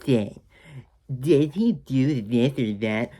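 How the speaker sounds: slow, insistent kid voice, lightly put on; kids interrupting. Kids stopping grandma's bedtime story, slow and insistent. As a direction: stretch it out like a fussy child. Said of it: "the kids are like".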